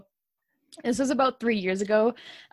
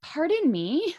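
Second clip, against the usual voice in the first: higher, startled pardon me; taken aback; 9 semitones higher, slower than usual. Retold or imitated. Retelling a shocked reply to a stranger, higher and startled. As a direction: recoil at the stranger's odd question. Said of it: "And I was like".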